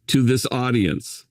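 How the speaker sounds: ordinary talk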